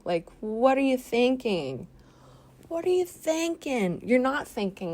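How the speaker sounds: high incredulous disbelief; exasperated. Real, not acted. Ranting at the wedding guest, high exasperation.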